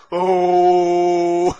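loud, long drawn "oh" bawl; imitated baby boy's cry. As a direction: bellow one sustained newborn cry. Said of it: "남자는". (남자아기 울음소리 흉내)

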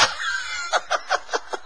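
long voiceless laugh; helpless airy chuckling; wheezy laugh. Real, not acted. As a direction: laugh breathlessly at your own story. Laughs at his army story.

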